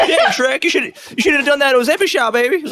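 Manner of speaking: high-pitched excitable sidekick; high cartoon needling; Donkey from Shrek. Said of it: "Donkey will make, like, a joke".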